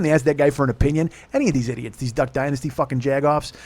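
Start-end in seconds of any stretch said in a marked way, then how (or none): none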